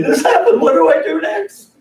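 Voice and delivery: high, loud, urgent phone-call quote; urgent call for help. Acted. Party in the other room calling somebody, high and urgent. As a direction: raise the pitch sharply, like calling for help.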